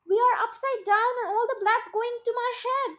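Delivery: restrained storybook scream; mild mock scream. A narrator's reading. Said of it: "screamed Missus Twit".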